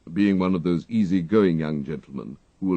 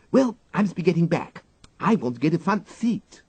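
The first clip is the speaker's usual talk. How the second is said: put-on higher, hurried little girl; eager schoolgirl; 11 semitones higher, 4 dB louder than usual. A narrator's reading. The girl hurries off to get a front seat, eager child. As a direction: dash off keen for a seat.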